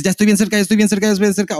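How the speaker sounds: mock relieved inner voice. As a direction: sing it out like you're almost there. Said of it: "al contrario que dices".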